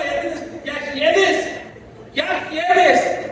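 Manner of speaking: high, loud preacher's cry; fiery preaching. Acted. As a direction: preach it hard at the congregation. Preaching at full force.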